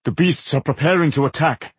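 understated anxious report, voice lifted; anxious warning. A narrator's reading. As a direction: warn them with a touch of alarm. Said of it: "He hurried over to the anxious Giselbert".

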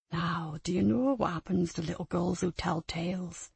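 low, soft, sinister threat; menacing quiet voice. Acted. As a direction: menace her in a hushed voice. Abuser menacing child Lucy.

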